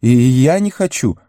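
high-pitched, hesitant reluctance; faltering. A narrator's reading. Sam admits he does not want to go, faltering. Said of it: "с запинкой пробормотал Сэм".

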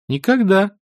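put-on high, emphatic boy's voice; a high, firm little denial; defiant youngster. A narrator's reading. Boy answers the wizard's question, defiant.

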